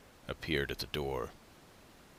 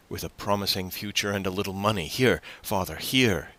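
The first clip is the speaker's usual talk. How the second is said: higher voice, pressing the offer; eager generosity; 5 semitones higher, 4 dB louder than usual. A narrator's reading. Young man offering his father money, eager. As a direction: urge it on him eagerly.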